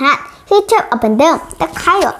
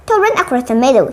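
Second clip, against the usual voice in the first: usual speaking voice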